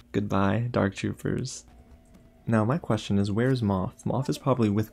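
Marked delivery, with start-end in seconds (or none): none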